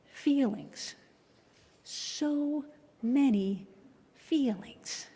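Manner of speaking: very slow high-pitched sigh of many; drawn-out refrain. Acted. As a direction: stretch so many out slowly and wistfully.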